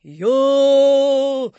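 loud, high, long-held wail; ghostly howl. A narrator's reading. Apparition answers Napoleon, drawn-out howl. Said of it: "словно завыло привидение".

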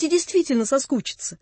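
plain reading voice

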